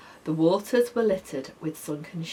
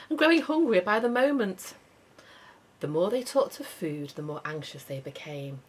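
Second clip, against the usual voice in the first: ordinary delivery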